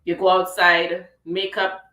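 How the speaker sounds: usual speaking voice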